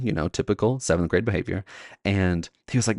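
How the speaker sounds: ordinary talk, nothing marked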